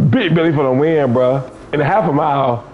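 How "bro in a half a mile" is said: ordinary delivery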